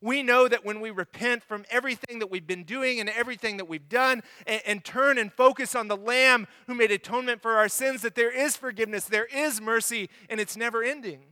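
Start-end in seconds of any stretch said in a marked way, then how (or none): none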